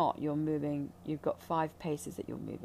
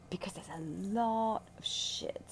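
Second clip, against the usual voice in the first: whispered aside. Real, not acted. Healer confiding to her listener, whispered aside. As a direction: drop to a breathy whisper on the word shit.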